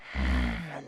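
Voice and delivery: long low exasperated sigh; weary sigh. Acted. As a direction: let out a tired breath before answering. Boss sighs before refusing doctor.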